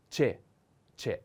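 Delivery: c'è said short and crisp; syllable demo. Shown as a demonstration. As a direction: blend c and e clearly. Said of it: "put together C and E".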